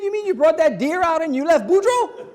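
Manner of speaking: loud, high-pitched disbelief; aghast, voice way up; incredulous outburst. Acted. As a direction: shout it in comic disbelief. Hunters incredulous he left Boudreaux.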